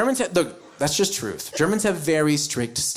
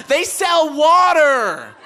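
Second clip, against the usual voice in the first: exasperated outburst; 8 semitones higher, 6 dB louder, slower than usual. Real, not acted. Exasperated punchline.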